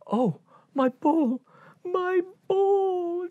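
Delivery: high, slow delighted cry; high gleeful girlish cry; high joyful princess; overjoyed princess. Acted.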